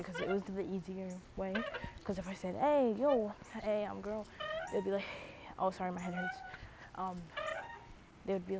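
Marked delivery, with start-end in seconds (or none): none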